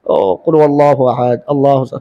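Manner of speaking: low murmured recitation, softening; imitating followers reciting. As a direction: mimic worshippers reciting along behind the imam. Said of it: "पीछे पढ़ रहे हैं पूरा". (पीछे नमाज़ियों की धीमी तिलावत की नकल)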